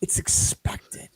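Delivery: slow whispered punchline; whispered aside. Real, not acted. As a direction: breathe it out, barely voiced. Muttered aside after rant.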